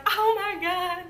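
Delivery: high, loud mock gush of joy; imagined gushing. Daughter imagining mom's thrilled reaction, high mock squeal. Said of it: "I thought she was gonna be like".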